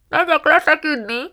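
high, loud, smothered announcement; muffled through cabbage. A narrator's reading. Margó, wrapped in cabbage, heads to bed, muffled.

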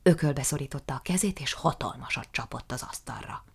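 plain reading voice

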